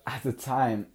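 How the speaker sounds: normal talking voice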